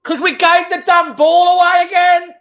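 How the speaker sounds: real frustration boiling over at the screen; yelling at his own team for giving it away; angry outburst. Real, not acted. Fan erupts at giveaway.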